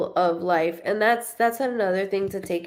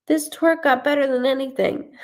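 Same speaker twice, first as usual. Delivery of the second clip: excited disbelief; 7 semitones higher than usual. Retold or imitated. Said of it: "She goes".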